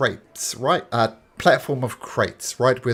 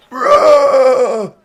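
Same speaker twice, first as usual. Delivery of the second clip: roaring crowd; 14 semitones higher, 12 dB louder than usual. Acted. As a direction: roar like a cheering crowd. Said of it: "The crowd goes".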